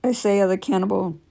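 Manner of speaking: plain reading voice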